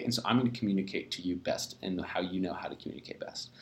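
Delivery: hushed whisper, breathy aside; soft whispered inner monologue; whispered thought. Retold or imitated. A three's inner thought voiced.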